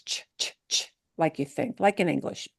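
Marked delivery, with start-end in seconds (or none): 0.1-0.9 crisp separate "ch" sounds, no vowel. Shown as a demonstration. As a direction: say "ch" as a short English ch, as in church. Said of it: "we do say it as".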